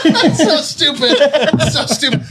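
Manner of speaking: laughing through the words; giggling while talking. Real, not acted. Laughing at the face contest.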